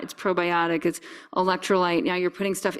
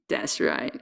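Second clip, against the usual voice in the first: lower, whispered soothing; 7 semitones lower, 4 dB quieter than usual. Real, not acted. Soothing the puppy again, whispered lower. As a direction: breathe it to the dog.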